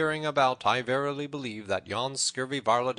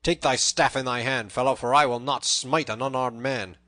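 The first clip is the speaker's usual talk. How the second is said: loud, raised angry threatening; in a rage; 5 semitones higher, 11 dB louder than usual. A narrator's reading. Little John challenges the tanner to fight, raging. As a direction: threaten him with a drubbing. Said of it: "cried Little John in a rage".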